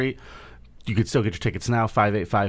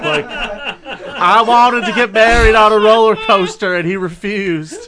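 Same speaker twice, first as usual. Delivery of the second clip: loud, high-pitched southern wife complaint; hammed-up southern accent, loud and high; 11 semitones higher, 11 dB louder than usual. Wife complaining about her husband's refusal, exaggerated southern accent. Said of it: "Why is there a southern accent?".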